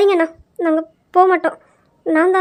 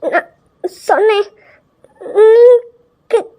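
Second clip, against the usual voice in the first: stuttering, frightened and higher; nervous stammer; 4 semitones higher than usual. Acted. Girl stammering her excuse to the angry brother.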